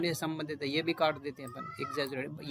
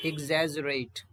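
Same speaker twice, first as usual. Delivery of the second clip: ordinary talk, nothing marked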